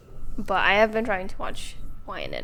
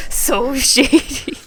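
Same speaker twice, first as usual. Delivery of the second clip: drawn-out, louder mock judgement; mock disapproving gossip; 4 semitones higher, 10 dB louder, much slower than usual. Townsperson judging the card buyer, drawn-out mock disapproval. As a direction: stretch it out like a scandalised busybody.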